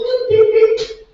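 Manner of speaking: tiny, cutesy high beeps; high-pitched beeping. Acted. Poet mocking Cupid's chubby baby disguise, high cutesy beeps. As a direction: squeak out little toy beeps. Mocks Cupid's cute noises.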